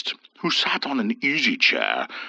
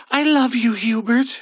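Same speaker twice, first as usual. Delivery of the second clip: a touch of a tearful cry, higher; understated gushing cry; 11 semitones higher, 3 dB louder than usual. A narrator's reading. Ethel crying out to Hubert, mild tearful gush. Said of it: "Ethel cried in gushing frustration".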